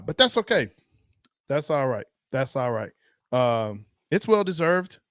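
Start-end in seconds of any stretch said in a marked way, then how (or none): none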